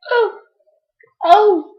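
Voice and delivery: high, loud comic "oh" yelps; mock shocked cry. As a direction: yelp in pretend shock. Acts out shocked cries.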